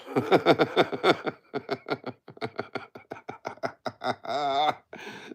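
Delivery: long, high staccato belly laugh; big laugh. Real, not acted. Streamer cracking up at a viewer's joke, long high laugh. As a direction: laugh hard, petering out then rising back up.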